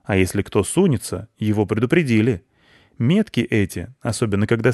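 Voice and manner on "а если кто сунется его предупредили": ordinary delivery, nothing marked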